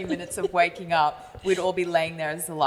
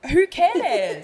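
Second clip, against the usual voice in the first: louder, higher scoff; dismissive; 6 semitones higher, 4 dB louder than usual. Retold or imitated. Mocking the producers' rule, a loud dismissive scoff. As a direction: toss it off with a scoff. Retelling mocking similar names.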